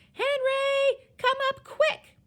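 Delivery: very high, loud summoning yell; alarmed mother's shout. A narrator's reading. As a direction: holler upstairs for your husband, urgently. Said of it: "And she yells".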